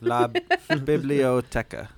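slow, mock Spanish word; slow playful Spanish; joke Spanish. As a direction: draw out la biblioteca as a joke answer. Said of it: "Now say it in Spanish".

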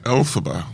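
Elphaba with the stress on EL; corrected pronunciation. Shown as a demonstration. Reader repeating the corrected name, Elphaba stressed on the first syllable. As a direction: say Elphaba as EL-fuh-ba, middle syllable reduced. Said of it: "name is pronounced Elphaba".

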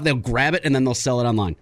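normal talking voice

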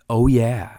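usual speaking voice

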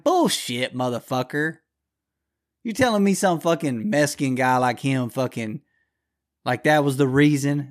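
scornful loud callout to the father; angry retort. Retold or imitated. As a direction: yell it back at him in disgust. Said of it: "I'm like".

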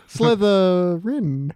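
slow, laughing drawl of the name; mocking the house name with a laugh; drawn-out mockery. Real, not acted. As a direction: stretch it out to mock it.

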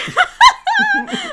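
laughter bursting out high; loud laughing shriek. Real, not acted. Big laugh.

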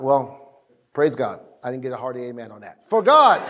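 ordinary talk, nothing marked

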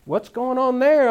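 high, alarmed double take, slowing on the question; startled. Retold or imitated. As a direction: play a newcomer thrown by the singing. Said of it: "and I'm like".

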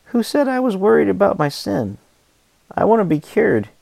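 retelling a friend's dream, a mock-indignant reply to Jesus; snorting retort. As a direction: scoff back at him, offended. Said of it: "Reynolds snorts back".

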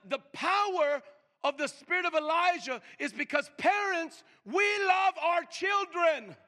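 rising to a loud high preacher's cry; preaching at full force. Acted. Preacher crying out to the church about parents' love. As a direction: build it up and shout we love our children.

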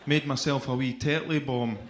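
usual speaking voice

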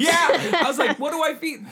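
laughing. Real, not acted. Laughing, high panicked.

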